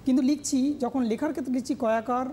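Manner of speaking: ordinary talk, nothing marked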